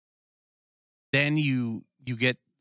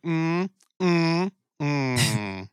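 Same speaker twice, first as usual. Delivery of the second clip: mock dismissive hum; 5 semitones higher, much slower than usual. Imitating the female cop's dismissive hum. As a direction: hum it off like the snooty cop.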